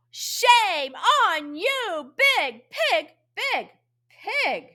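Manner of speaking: high, slow, drawn-out scolding. Acted. Asker scolding the big pig in the poem, high voice. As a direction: chide from up high, stretching the words. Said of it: "we use a high voice".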